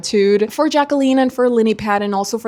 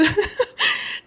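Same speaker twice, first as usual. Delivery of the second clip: breathy laugh; 10 semitones higher, 5 dB quieter than usual. Real, not acted.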